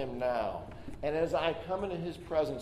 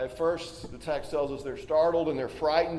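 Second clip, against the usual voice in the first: usual speaking voice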